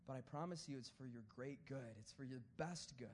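low, soft heartfelt promise; sincere reassurance. Real, not acted. Preacher promising God's harder way is for good, tender reassurance. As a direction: soften right down to reassure.

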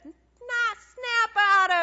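high, loud crying shout of the Lord; the Lord snapping. Retold or imitated. The Lord telling a fool to snap out of it, in a high crying shout. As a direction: shout him awake like the Lord. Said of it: "the Lord has said".

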